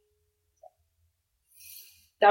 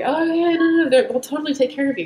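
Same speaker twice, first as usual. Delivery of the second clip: much higher, louder brush-off of worries; imitated breezy reassurance; 8 semitones higher, 4 dB louder, faster than usual. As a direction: mimic someone eagerly waving worries away. Imitates the man breezily reassuring her.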